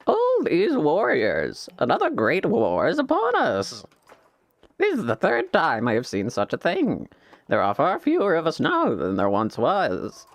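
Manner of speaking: lightly voiced old warrior; old warrior character. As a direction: read the elder's lament in character. Voices game character dialogue.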